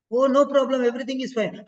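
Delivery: high, bright-voiced reassurance to a patient; reassuring. Shown as a demonstration. Teacher demoing reassurance to a patient. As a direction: sound like nothing is wrong. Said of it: "How does that sound?".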